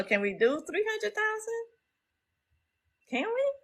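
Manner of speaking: high, hopeful asking; excited pleading. Real, not acted. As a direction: squeak out the question in disbelief.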